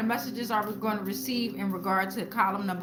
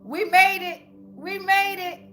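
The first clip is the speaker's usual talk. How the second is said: louder and higher, celebrating; excited cheer; 5 semitones higher, 6 dB louder than usual. Real, not acted. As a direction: cheer it out like a victory. Lost souls card finally comes out.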